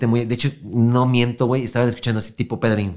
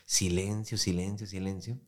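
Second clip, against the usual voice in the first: hushed silence; 2 semitones lower, 11 dB quieter than usual. A narrator's reading. Empty city, total silence.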